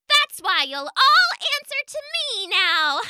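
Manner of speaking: very high tiny bug voice, taunting; buzzing gnat voice. Acted. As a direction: buzz it like a gloating gnat. Gnat gloating.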